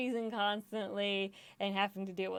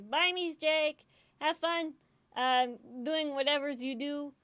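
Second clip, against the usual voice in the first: high, loud sing-out farewell; cheery goodbye; 6 semitones higher, 4 dB louder, slower than usual. Real, not acted. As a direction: call goodbye, pitched up. Calling goodbye to a friend.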